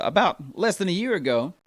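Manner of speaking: ordinary talk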